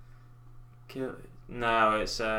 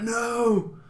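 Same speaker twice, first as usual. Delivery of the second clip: retold shout; 11 semitones higher, 4 dB louder than usual. Nephew drinks paint water.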